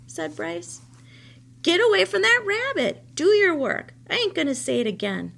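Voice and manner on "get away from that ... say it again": high, loud furious scolding; angry scolding. Acted. Old woman angrily ordering the boy back to work. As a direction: tear into him, loud.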